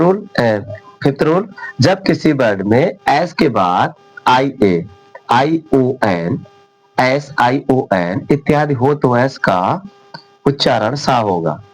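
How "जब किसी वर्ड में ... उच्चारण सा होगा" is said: slow dictation. Shown as a demonstration. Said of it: "states the rule slowly".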